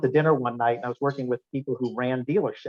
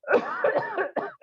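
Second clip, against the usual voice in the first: demonstrated cough. Retold or imitated. Speaker showing how he coughed in the elevator. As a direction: cough hard into the room, mouth uncovered. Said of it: "I start going".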